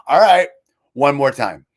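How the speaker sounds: playfully fed up, going for another round; weary retry. Retold or imitated.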